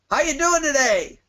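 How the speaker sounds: high, bright sing-song booth greeting; overly cheery trade-show greeter. Retold or imitated. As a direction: chirp it at a passerby like a salesperson. Booth staffer greeting passersby.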